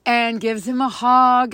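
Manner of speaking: usual speaking voice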